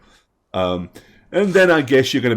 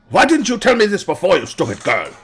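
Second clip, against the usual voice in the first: louder, higher, fast scolding; father scolds his daughter, loud and fast; angry father; 9 semitones higher, 5 dB louder, faster than usual. Acted. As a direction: snap at the daughter. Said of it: "angry father scolds daughter".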